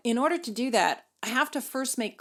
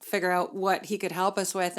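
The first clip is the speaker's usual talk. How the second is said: usual speaking voice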